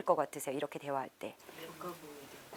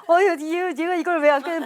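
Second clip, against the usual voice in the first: higher, louder, a mother getting worked up; mock fuming mother; 6 semitones higher, 4 dB louder than usual. Watching her son game, mother gets mock angry, higher and louder. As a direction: play the irritated mom, raised and loud.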